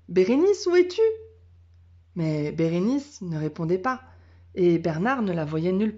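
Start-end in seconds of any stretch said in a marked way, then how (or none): 0.1-1.0 anxious call. A narrator's reading. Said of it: "ce qui voulait dire".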